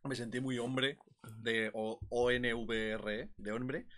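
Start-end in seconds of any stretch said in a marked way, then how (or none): 1.7-3.3 slow, lower letter-by-letter spelling; spelled out. Real, not acted. Friend joking that he felt very manly, spelling it slowly. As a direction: spell it out with mock solemnity.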